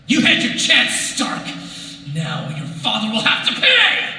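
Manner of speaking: high, loud villain shout; Mandarin villain yell. Retold or imitated. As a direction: bellow it like a comic book villain. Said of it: "Just yells a lot".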